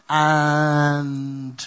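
one long, level, held "and"; suspenseful stretch. Real, not acted. Preacher teasing the third joy-robber to the congregation, suspenseful stretch. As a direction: hang on the word to build suspense.

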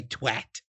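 "twat" as TWAT, vowel of "cat"; "twat" rhyming with at. Shown as a demonstration. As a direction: use a short front A in "twat". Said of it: "expect us to say".

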